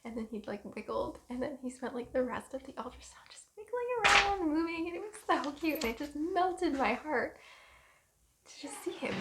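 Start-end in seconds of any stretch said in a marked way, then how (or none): 3.3-9.2 high, melting coo; pitched up and swooning with love; gushing adoration. Real, not acted. Mother recalling her baby wiggling on screen, gushing.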